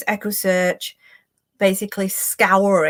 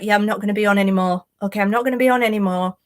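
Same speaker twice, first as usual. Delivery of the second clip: mock dismissive; much faster than usual. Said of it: "so he's like".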